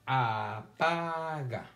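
"Apaga" slowly stretched, aa-paa-ga; syllable by syllable. Shown as a demonstration. Teacher pointing out the middle syllable, syllable by syllable. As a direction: draw out each syllable of "Apaga". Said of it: "y aquí sí hay sílaba del medio".

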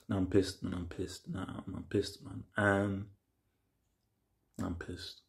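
muttered under breath. Real, not acted. Annoyed viewer muttering after episode.

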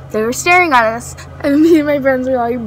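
ordinary talk

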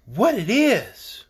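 ordinary delivery

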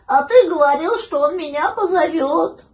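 lightly plaintive high puppy voice; mild high-pitched puppy reproach; sighing puppy. A narrator's reading. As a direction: complain loudly as a let-down little pup. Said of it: "вздохнул щенок".

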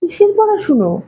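high, puzzled, astonished question; baffled, high-pitched query; very surprised. A narrator's reading. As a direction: ask as if the idea is absurd. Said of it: "উনি খুব অবাক হয়ে বললেন".

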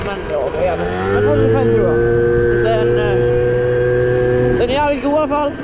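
ordinary talk

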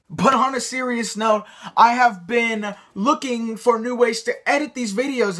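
normal talking voice

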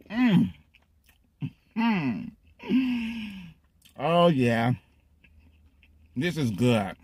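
chewing between words; muffled by sandwich bite; mouth full of food. Real, not acted. Seen 0: Bites at -9, pursed chewing lips and food in mouth throughout.

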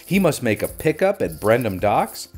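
ordinary delivery, nothing marked

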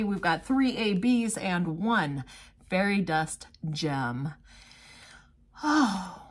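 ordinary talk

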